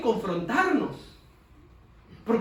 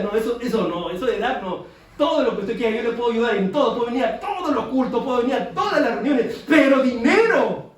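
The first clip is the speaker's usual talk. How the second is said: loud, eager then flat refusal on "dinero"; mock believer who won't give money; stingy believer; 3 semitones higher, 7 dB louder than usual.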